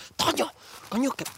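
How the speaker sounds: urgent hushed plea. Acted. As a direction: shush him so the tiger won't hear. Hushing partner, fears tiger.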